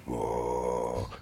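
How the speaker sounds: a low, soft, drawn-out whoa from deep inside; mystical inner voice. Retold or imitated. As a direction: let an otherworldly voice rise up through you. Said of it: "all of a sudden I hear".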